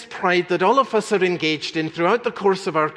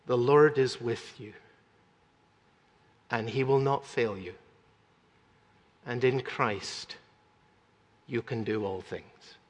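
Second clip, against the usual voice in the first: gentle reassurance; 5 semitones lower, 6 dB quieter than usual. Retold or imitated. Preacher to congregation, modelling how a parent blesses, soft and tender.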